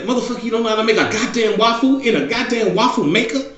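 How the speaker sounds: high incredulous mock yell; exasperated yell at the boy who can't make a waffle. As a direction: shout at him in disbelief. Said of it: "I was like".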